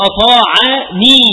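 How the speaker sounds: slow, drawn-out "أطاعني" with the nun held clearly; stretched for the noon. Shown as a demonstration. Teacher showing students the added nun, slow and deliberate. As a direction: spell out "أطاعني" slowly so the nun of protection stands out. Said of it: "ما هذه النون؟".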